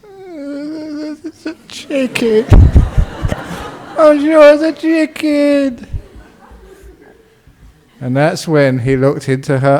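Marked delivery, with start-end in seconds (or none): 0.0-2.5 high, slow, wailing sob; hammed-up tearful whine, drawn out; mock crying. Wife starting to cry over the argument, mock sobbing. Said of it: "she started to cry". 4.0-5.9 high, drawn-out tearful wail; mock crying. Wife still insisting through her tears, mock crying. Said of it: "she started to cry".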